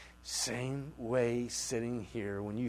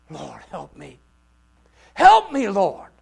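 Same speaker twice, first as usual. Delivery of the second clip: climbing to a loud, high cry; 6 semitones higher, 20 dB louder than usual. Acted. Preacher voicing the woman crying out, pleading. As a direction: beg the Lord louder each time. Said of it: "crying out".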